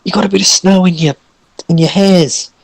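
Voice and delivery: high, hesitant character voice; timid, apologetic Orson. Acted. Orson telling Kathmandu about the snow, awkward and high. Game master plays Orson.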